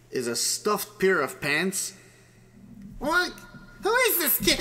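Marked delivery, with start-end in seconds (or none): 3.0-4.6 high-pitched alarmed disbelief; startled. Acted. Wife finds a kid in the closet, startled. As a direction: shoot up high in startled confusion.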